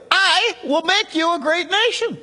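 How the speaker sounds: "I" hit hard in a high, ringing declaration; emphatic opening I. Shown as a demonstration. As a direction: proclaim it high, leaning on "I". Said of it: "emphasis was gonna be on the beginning".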